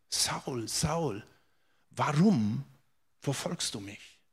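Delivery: „warum“ gedehnt und abgesetzt, lauter als der leise Rest; Betonung auf WARUM. Acted. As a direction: leise mahnend, mit Gewicht auf „warum“. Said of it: "möchte diese Betonung auf dieses Warum setzen". („warum“ betonen, mit Pause davor und danach)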